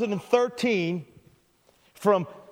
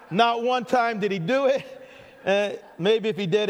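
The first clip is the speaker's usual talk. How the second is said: ordinary talk, nothing marked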